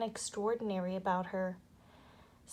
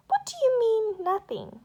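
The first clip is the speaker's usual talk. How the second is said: much higher, louder offended demand; indignant demand; 12 semitones higher, 9 dB louder than usual. A narrator's reading. Insulted nanny demanding an answer, loud and high. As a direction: bristle as if insulted. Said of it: "demanded Mary Poppins".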